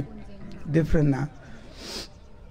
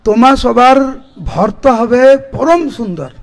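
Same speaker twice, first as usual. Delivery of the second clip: quick verse recitation; 5 semitones higher, 7 dB louder, much faster than usual. Acted. Mahaprabhu blessing the girls, fast raised recitation. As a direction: rattle off the Bengali verse, louder.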